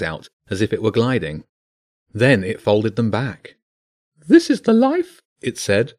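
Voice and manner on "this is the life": high, loud bird voice; a pigeon relishing the ride, pitched high; high bird voice full of delight; contented pigeon. Acted.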